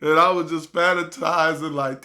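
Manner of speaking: ordinary talk, nothing marked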